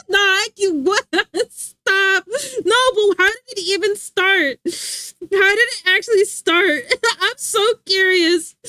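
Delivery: high, incredulous and breaking into laughs; laughing disbelief. Real, not acted. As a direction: demand the story while cracking up. Laughing disbelief at a viewer's story.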